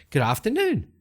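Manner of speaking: high, sing-song greeting; lifted, lilting call of greeting; chirped greeting. Acted. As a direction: pitch it right up, like hailing a stranger. Hero greets mage, correcting herself.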